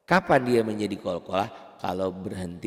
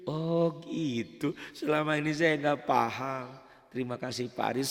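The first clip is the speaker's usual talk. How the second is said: higher-pitched put-on pupil voice; grateful student; 5 semitones higher than usual. Student thanks Pak Aris for the lesson, relieved realization. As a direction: play a pupil who finally gets it.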